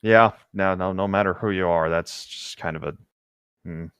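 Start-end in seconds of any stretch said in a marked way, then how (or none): none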